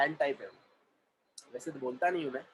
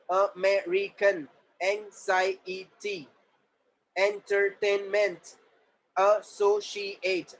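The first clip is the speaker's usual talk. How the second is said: higher, word-by-word classroom dictation; syllable-by-syllable drill; 3 semitones higher, 4 dB louder, much slower than usual. Shown as a demonstration. Teacher breaking words into syllables for students, drilled evenly. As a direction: sound out each syllable for the class.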